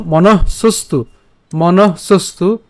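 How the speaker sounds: "মনঃসস্তু" said slowly, syllable by syllable; careful slowed "মনঃসস্তু"; slow syllable drill. Shown as a demonstration. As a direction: drill "মনঃসস্তু" deliberately for students. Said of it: "এই যে রেফটা আমরা আগে উচ্চারণ করছি".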